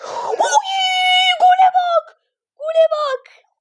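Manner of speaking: very high, drawn-out squeal of excitement; thrilled outcry. Real, not acted. Gamer marvels at a great goal, thrilled outcry. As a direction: cry out in amazement at the goal.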